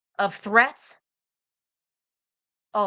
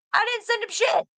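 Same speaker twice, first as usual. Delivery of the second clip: mocking quote; 12 semitones higher than usual. Quoting chat, pitch jumps.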